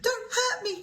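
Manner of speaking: high, pleading mock whimper; frightened lion begging the mouse. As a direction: beg like a scared big cat. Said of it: "he whimpered".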